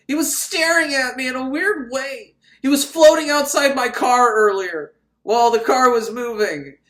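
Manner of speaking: high, loud hammed-up accusation; mock panic. Woman accusing the floating man to the doctor, high mock panic. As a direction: build from high protest to loud alarm.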